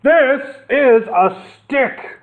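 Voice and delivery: high, slow shout. Acted. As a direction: yell it in outrage. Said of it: "Horace shouted".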